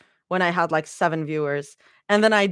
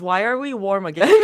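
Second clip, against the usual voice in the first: normal talking voice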